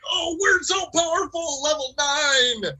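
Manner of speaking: high, shouted heroic boast; mock heroic. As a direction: boast like a triumphant He-Man. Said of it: "we all like".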